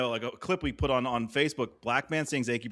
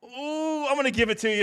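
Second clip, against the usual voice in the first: hyped up; 12 semitones higher than usual. Pretend. Joking hype before a lyric.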